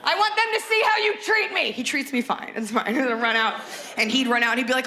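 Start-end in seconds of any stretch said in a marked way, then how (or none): 0.0-1.7 high-pitched theatrical complaint; hammed-up girlfriend screech; mock outraged yell. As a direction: play it up for the neighbors. Acted girlfriend yelling for the neighbors.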